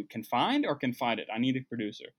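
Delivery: ordinary talk, nothing marked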